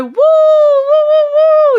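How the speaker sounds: long, resonant high whoops; operatic, sustained high woo; loud high wooing. Shown as a demonstration. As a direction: show off a theatre audience woo. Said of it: "when I go".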